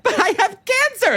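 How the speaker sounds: a high, pitiful play for sympathy; whiny cancer card. Retold or imitated. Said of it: "The judge will be like".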